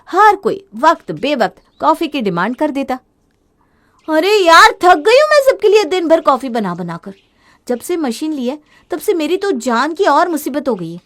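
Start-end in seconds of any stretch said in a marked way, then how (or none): 4.1-7.1 louder, exasperated outburst; worn out, loudly fed up; fed-up complaint. Acted. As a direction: vent how sick of it she is. Tired of making coffee.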